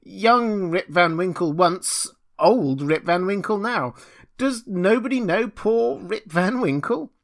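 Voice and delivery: slow, high, loud appeal to the crowd; impassioned cry. A narrator's reading. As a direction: plead out loud to be recognised. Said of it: "cried he".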